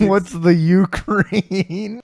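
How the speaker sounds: high, slow mock Russian echo. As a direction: drag the question out mockingly. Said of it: "It's, it's".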